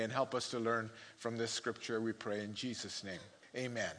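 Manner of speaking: soft, hushed prayer voice, fading to a murmur. Acted. Pastor praying over the congregation, soft and hushed. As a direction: pray quietly over the congregation before the sermon.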